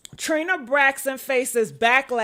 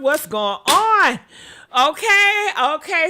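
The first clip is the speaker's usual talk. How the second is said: normal talking voice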